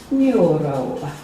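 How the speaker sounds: slow mock-Italian delivery; Italian accent. Trainer jokingly spelling out NLP for the class, Italian accent. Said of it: "Still say it in an Italian accent".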